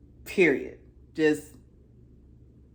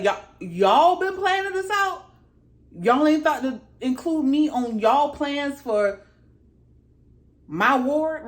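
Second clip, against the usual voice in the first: high, loud affronted questioning; mock-outraged retelling, pitched up; indignant disbelief; 6 semitones higher, 4 dB louder than usual. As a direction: act out being left out of the plans, incredulous. Acting out Aegon learning of secret plans.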